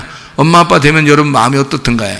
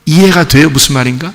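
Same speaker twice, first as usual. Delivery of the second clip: normal talking voice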